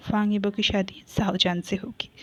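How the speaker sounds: soft, low whisper; quiet whispered verdict to her sister; whispered quietly. A narrator's reading. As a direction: breathe it out, barely voiced. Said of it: "चुप से कहते हैं".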